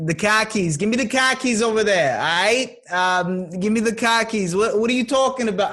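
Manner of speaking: loud, high Boston accent. Shown as a demonstration. Podcast host asked for a Boston accent, loud and high. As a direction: belt it out like a Boston guy.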